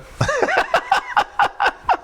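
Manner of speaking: very high, cracking laugh; full belly laugh breaking high; big laugh. Real, not acted. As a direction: let out a shrieking laugh at your own joke. Laughing at own joke.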